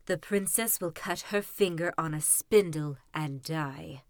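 low, spiteful wicked fairy voice; spiteful old fairy. Acted. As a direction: curse the baby with low spite. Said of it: "Spitefully she said".

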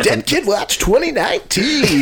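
radio announcer. Pretend. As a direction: announce it like a radio segment title. Mock radio segment title.

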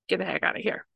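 ordinary delivery

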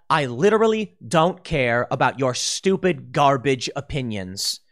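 usual speaking voice

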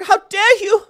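shrieking mock of a young activist's scolding; Greta Thunberg impression. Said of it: "listen to Greta Thunberg".

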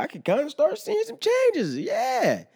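high falsetto, hammed-up vanity; falsetto self-praise. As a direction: preen at the mirror in a squeaky high voice. Admiring himself in the mirror.

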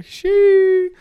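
loud high admiring "shee"; excited whistle-like exclamation. Real, not acted. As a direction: blurt it out, impressed. Admiring his own side by side.

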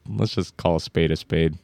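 ordinary talk, nothing marked

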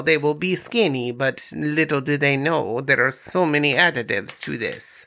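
lightly held Borat impression, Eastern European accent. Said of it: "I'm Borat".

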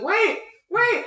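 high urgent plea to hold on; high-pitched panicked cry; Durdur calling out. Acted. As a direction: call out high, desperate to be waited for. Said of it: "He's like".

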